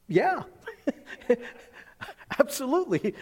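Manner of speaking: high, delighted yes broken by laughter; eager amused reply. Retold or imitated. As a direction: laugh through an emphatic, high-pitched agreement. Acting own eager, amused reply.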